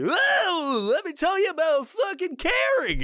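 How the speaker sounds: a wounded purple dinosaur preaching kindness; skinned Barney voice. Retold or imitated. Mocking the video host as a skinned Barney, high strained voice. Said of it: "he's doing this fucking voice like he's like Barney the dinosaur that was skinned".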